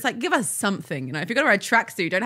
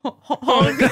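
loud honking noises; mock car-horn honks; imitated honk; 5 semitones higher, 5 dB louder, slower than usual. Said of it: "in your head you're like".

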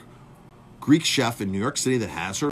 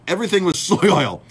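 laughing through the words, higher and louder; amused disbelief with a laugh; 5 semitones higher, 7 dB louder than usual. Real, not acted. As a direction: let a real laugh break into it. Laughing at the story.

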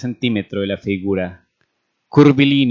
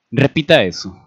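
mildly incredulous, pitched up a little; 3 semitones higher, 4 dB louder than usual. A narrator's reading. Major asking the doctor to repeat himself, incredulous. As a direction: ask for it with disbelief. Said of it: "solicitó Bollard incrédulo".

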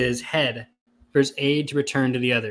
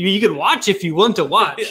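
cheerful mock offer; 6 semitones higher, 5 dB louder than usual.

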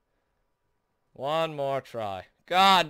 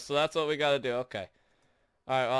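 ordinary delivery

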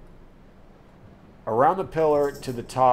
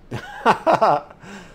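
high burst of laughter; 8 semitones higher, 5 dB louder than usual. Real, not acted. Laughing at streamer's joke.

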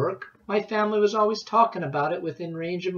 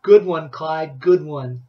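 loud praise; 8 dB louder, slower than usual. A narrator's reading. Boy praising the act loudly.

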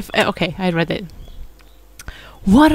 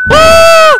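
scream; 13 dB louder than usual. Real, not acted. Monster appears again.

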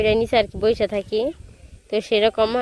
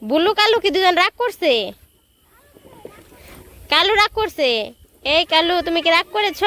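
cooing pet talk; 8 semitones higher than usual. Real, not acted. Cooing to pets Bulu and Kalu. (Blue আর কালুর সাথে উঁচু গলায় আদুরে কথা)

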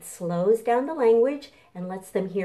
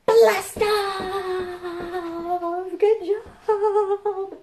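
high, loud blast-off cheer; excited launch call; 12 semitones higher, 6 dB louder, much slower than usual. Pretend. Storytime host cheering the launch for children, excited high call. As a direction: shout it like the rocket just lifted off.

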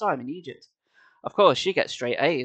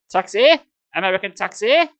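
higher, louder cry of taxi; high shrill voice; 4 semitones higher, 6 dB louder than usual. Acted. Robed man calling out to the boy, high shrill cry. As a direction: call out high like a street hawker. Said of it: "he said in a high, shrill voice".

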